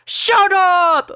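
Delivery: high, loud mock roar; hammy roar. Lupin roaring at Harry, hammed-up. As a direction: bellow it like a stage brawler. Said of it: "Lupin roared".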